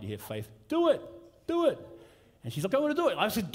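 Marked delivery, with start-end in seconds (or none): 0.7-1.8 put-on high excited kids' chant; kids egging on. Kids at home urging the skater in a high cheer. 2.7-3.2 put-on eager child. Daughter voiced in story.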